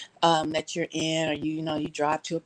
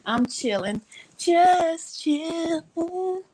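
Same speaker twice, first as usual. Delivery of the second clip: higher, slow, dreamy chill voice; laid-back host voice; 9 semitones higher, slower than usual. Retold or imitated. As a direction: drawl it like a lazy host. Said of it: "And he's like".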